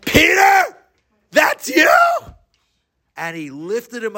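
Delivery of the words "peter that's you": high, loud disbelieving shout; surprised shout. Acted. Big man seeing tiny Peter, loud surprised shout. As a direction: bark it out in shocked disbelief.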